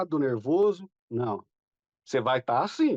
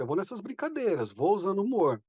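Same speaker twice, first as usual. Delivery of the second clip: ordinary talk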